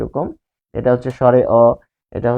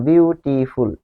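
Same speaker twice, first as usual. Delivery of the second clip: "Beautiful" slowly in three syllables; much slower than usual. Shown as a demonstration. Teacher showing what a syllable is, "beautiful" said slowly syllable by syllable. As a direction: measure out "beautiful" as বিউ, টি, ফুল. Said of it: "তিনটা syllable".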